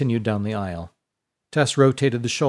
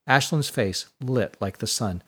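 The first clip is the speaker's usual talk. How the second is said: plain reading voice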